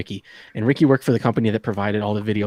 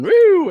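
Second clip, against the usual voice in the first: high, loud police siren blip; short loud vocal siren; imitated siren whoop; 8 dB louder than usual. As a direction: whoop like a squad car siren.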